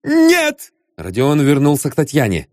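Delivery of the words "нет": put-on high, startled girl's answer; high startled denial; frightened young lady. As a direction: blurt it out like a scared young lady. Frightened girl answers detective.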